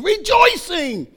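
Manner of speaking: high, loud, drawn-out cry; exultant preaching. Real, not acted. Preacher reciting scripture on returning with joy, exultant. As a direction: ring the word out slowly and loudly.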